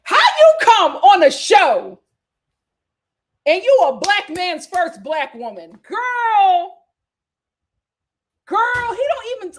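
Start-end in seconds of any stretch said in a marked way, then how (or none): 0.0-5.7 exasperated. Real, not acted. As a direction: drag it out, loud and fed up. Exasperated rant about couple.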